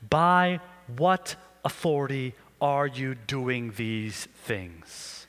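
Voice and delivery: slow, low, weighty demand; stern priests. Acted. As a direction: draw the question out with menace. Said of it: "the scene kinda looks like this".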